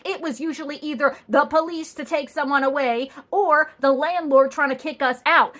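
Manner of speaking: ordinary delivery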